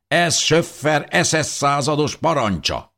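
high-pitched official proclamation, called out; town crier's shout. A narrator's reading. Town crier announces the SS captain's order, shouting. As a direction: cry out the order for all to hear. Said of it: "kiáltotta".

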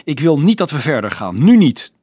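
loud refusal rising to a cry; high, yelled insistence; shrieked protest. A narrator's reading. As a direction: protest at the top of your voice. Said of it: "gilde Nicolaas".

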